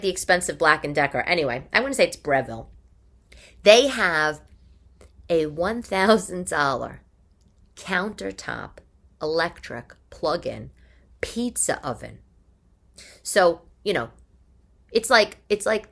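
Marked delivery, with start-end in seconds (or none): 3.6-12.2 slow, deliberate, pausing; slow emphasis. Real, not acted. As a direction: spell out each word for effect. Slow emphatic listing of the price.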